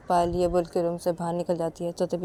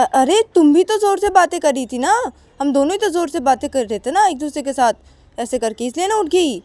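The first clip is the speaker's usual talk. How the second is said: higher, louder defensive retort; husband argues back with his wife, loud and defensive; 8 semitones higher, 10 dB louder than usual. Acted. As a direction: shout back it was both of you. Quarrel, Akash defends.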